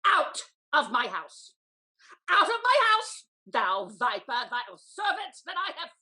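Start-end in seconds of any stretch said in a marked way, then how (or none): 0.1-1.5 slow, lower, louder command; angry order. Acted. Lady throwing out her servant. 2.3-3.2 higher, louder enraged cry; furious shout. Acted. Lady throwing out her servant.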